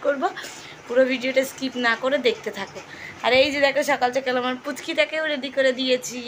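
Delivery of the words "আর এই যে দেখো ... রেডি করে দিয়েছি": ordinary delivery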